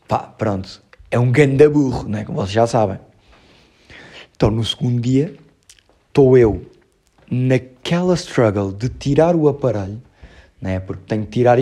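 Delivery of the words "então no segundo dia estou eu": normal talking voice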